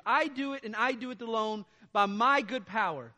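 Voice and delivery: loud, driving sermon voice; preaching at full force. Acted. Preacher rousing the congregation about God's power, preaching hard. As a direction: hammer home that God does it all.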